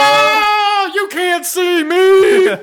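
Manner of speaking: high, loud, slow mock Cena taunt; wrestler catchphrase shout. Hearing John Cena drawn, high loud slow catchphrase shout. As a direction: bellow it like a ring entrance.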